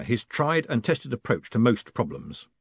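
ordinary delivery, nothing marked

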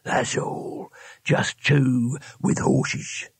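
whispered, very slow ferryman; muttered. Acted. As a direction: breathe it out slowly. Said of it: "it muttered".

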